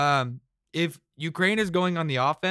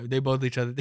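ordinary delivery

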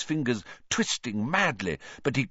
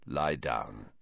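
low, quiet command; hushed and deep; quiet order; 12 semitones lower, 8 dB quieter than usual. A narrator's reading. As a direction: order him softly, very low. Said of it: "he said quietly to Ron".

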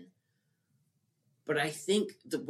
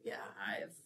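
whispered aside, breathy and low; 4 semitones lower, 14 dB quieter, much faster than usual. Real, not acted. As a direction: drop to a whisper.

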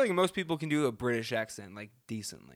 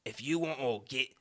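mock tough British-Australian; British turning Australian accent. Host acts a brawler challenging a mate, British drifting into Australian accent. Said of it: "more of an Australian accent now".

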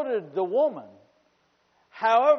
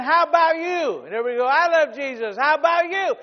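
call and response; 6 semitones higher, 7 dB louder than usual. Retold or imitated. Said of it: "would be yelling back and forth".

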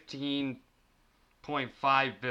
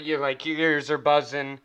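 ordinary talk, nothing marked

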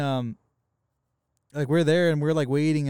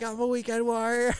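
very high, strained mock scream; Weekend Warrior; 12 semitones higher than usual. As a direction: squeal it long like a raving partygoer. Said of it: "he's screaming".

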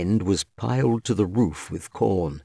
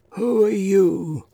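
put-on high, slow, severe old voice; stern old man; 11 semitones higher, slower than usual. A narrator's reading. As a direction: demand it like a stern old master. Stern old master challenges stranger.

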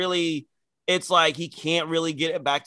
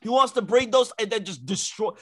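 normal talking voice

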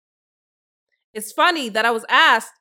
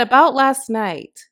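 quoting Amanda Seales; 7 dB louder, slower than usual. Retold or imitated. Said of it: "she said".

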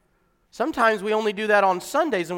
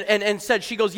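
normal talking voice

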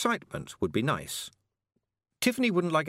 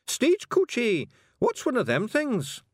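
high, slow puzzled question; puzzled Scottish passenger; 4 dB louder, slower than usual. A narrator's reading. Passenger asks the carter about stagecoaches, high and puzzled. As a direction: draw it out in high Scots puzzlement.

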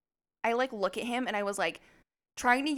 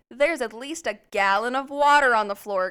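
louder, exasperated retelling of Zach; loud mock complaint; 2 semitones higher, 8 dB louder than usual. Imitating Zach scolding his girlfriend, loud and fed up. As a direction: push it out like a fed-up boyfriend.

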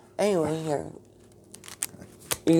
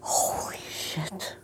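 slow whisper of Holy shit; whispered exasperation; 2 semitones higher, 8 dB quieter, much slower than usual. Real, not acted. Exasperated whisper to herself when her co-host asks what to talk about. Exasperated aside under breath.

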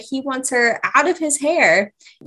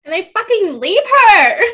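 outraged; 8 semitones higher, 7 dB louder than usual. Real, not acted. Outraged at the abandonment.

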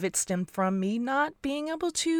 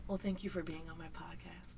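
unvoiced whispered thanks; whispering; 2 semitones lower, 15 dB quieter than usual. Real, not acted. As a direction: whisper the sign-off. Whispered mock sign-off.

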